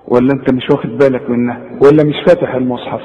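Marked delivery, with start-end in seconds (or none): none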